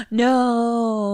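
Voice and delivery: long, high drawn-out "no"; mock horror. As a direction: gasp and wail in mock dread. Mock shock at the threat.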